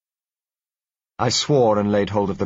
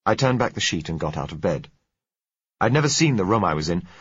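ordinary delivery

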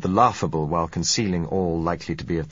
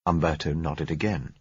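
usual speaking voice

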